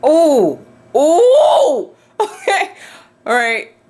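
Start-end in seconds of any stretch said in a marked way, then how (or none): 0.0-1.9 loud, rising startled oohs; two loud "oh"s, the second leaping high. Real, not acted. Scared reaction to bug.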